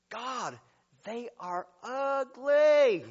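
slow, drawn-out disdainful remark; mock disgust. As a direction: drag it out like you're grossed out. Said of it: "and I'm like".

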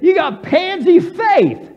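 high, loud, drawn-out scolding; shouted rebuke. Acted. Jesus rebuking Peter for doubting, exasperated shout. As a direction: shout the reproach at him.

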